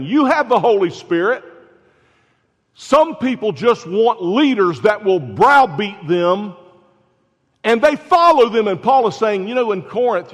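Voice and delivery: loud, high preaching call to the congregation; rising up, preach it hard at them. Acted. Preaching at full force.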